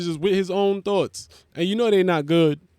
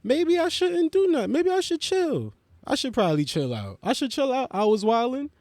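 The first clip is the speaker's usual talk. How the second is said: high stoner; 8 semitones higher than usual. Retold or imitated. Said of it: "you be like".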